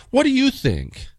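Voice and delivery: a hint of the wife's question; imitating wife. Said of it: "says something to the effect of".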